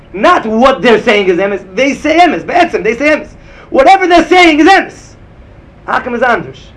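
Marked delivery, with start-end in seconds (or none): 0.1-5.2 emphatic teaching. Real, not acted. Rebbe hammers the key distinction.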